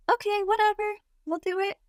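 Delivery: high, singsong mock agreement; acted offhand compliance. Quotes own airy compliance.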